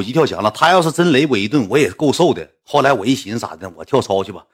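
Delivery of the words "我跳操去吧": usual speaking voice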